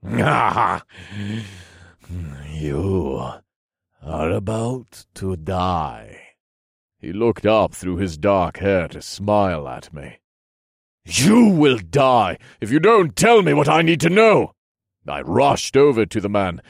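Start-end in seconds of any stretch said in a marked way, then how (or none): 0.0-6.3 slow, sinister menace; drawn-out, menacing villain voice; villain's threat. A narrator's reading. Laughing villain threatens hero. 11.0-14.5 loud, high, furious demand; angry raised-voice ultimatum; impatient threat. A narrator's reading. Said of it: "I was growing impatient with him".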